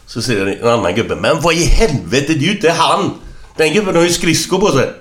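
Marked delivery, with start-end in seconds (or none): 1.1-4.9 high, loud, astonished exclamation; incredulous outcry. Acted. Another fisherman discovering the wrong body, incredulous. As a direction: deliver the punchline in shrieked disbelief.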